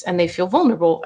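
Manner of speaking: ordinary talk, nothing marked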